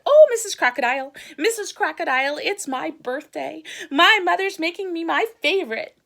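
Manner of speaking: high, loud, eager little frog; cheerful frog voice. Acted. Frog chattering to the crocodile about his birthday, high and eager. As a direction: chirp it up like an excited frog.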